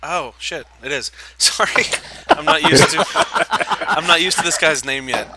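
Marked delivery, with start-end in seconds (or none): 1.2-5.3 real embarrassed laughter, louder, through the apology; laughing. Real, not acted. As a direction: laugh it off after missing your turn. Embarrassed player laughing at mistake.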